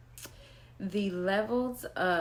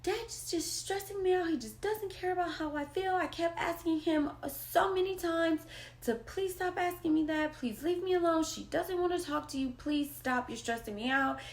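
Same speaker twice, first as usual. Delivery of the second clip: put-on higher, pleading teen voice; upset daughter; 9 semitones higher than usual. Daughter complaining to mom about her dad, high and pleading. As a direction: plead like a stressed kid begging to be left alone. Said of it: "she's like".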